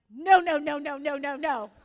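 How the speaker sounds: very loud, rapid string of no's; panicked plea. Retold or imitated. Mother to child about to be sick, loudly panicked. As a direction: blurt it out in mock panic.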